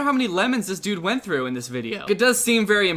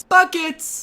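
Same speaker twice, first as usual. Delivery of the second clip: high echo of the friend's cheer; a hint of a hyped-up friend; mock hype echo; 12 semitones higher, 5 dB louder than usual. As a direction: hype it like a sports fan. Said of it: "echoing the friend".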